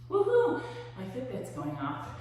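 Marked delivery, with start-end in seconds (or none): 0.1-0.6 high, loud celebratory whoop; cheer. Real, not acted. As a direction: cheer the class on. Cheering at workout end.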